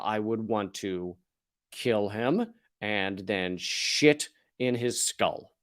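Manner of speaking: low, slow, deadpan revenge fantasy; low dark deadpan. Pretend. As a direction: murmur it low like a dark confession. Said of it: "my response to that is".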